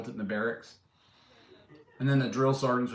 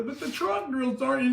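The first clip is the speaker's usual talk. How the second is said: high-pitched mock private protesting; high, put-on private's voice; complaining private; 13 semitones higher than usual. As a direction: imitate a private pleading with the sergeant. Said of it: "And they go".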